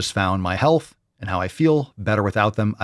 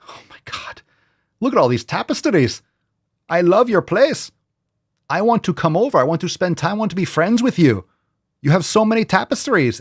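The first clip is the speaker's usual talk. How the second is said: higher, gushing visitor voice; gushing guest; 8 semitones higher than usual. Retold or imitated. Imagined guest gushing over the host's tapestries. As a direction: gush like an impressed house guest. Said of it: "being like".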